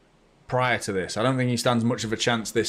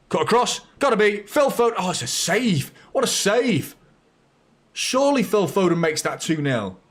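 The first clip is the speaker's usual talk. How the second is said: rising, louder calls as the chance builds; excited commentary; 9 semitones higher, 3 dB louder than usual. Real, not acted. Fan calling a City chance live, excited and rising. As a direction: call the chance like it's going in. Chance for Foden, big save.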